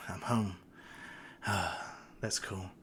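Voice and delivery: low, soft murmur with a sigh; hushed, sighing acted line; quiet contentment. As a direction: say it softly to yourself. Boy alone, just waking.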